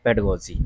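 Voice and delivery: "पेडागोजी" run together as PED-uh-go-jee; short unstressed middle "a" in "पेडागोजी"; taught pronunciation. Shown as a demonstration. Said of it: "इसका जो सही उच्चारण है".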